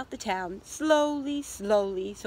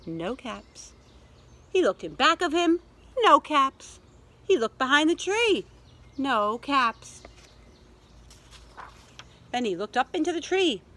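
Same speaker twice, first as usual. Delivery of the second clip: suspenseful picture-book reading, swinging from hushed to emphatic; story narration; 2 semitones higher, 3 dB louder than usual. A narrator's reading. Peddler searches around the tree, suspenseful storytelling. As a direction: build the search for little listeners.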